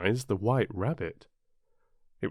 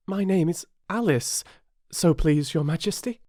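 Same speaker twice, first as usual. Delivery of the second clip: put-on higher, polite little-girl voice; very polite Alice; 8 semitones higher than usual. A narrator's reading. Alice answering the Queen, very polite higher voice. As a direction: answer with careful politeness, pitched up. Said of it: "very politely".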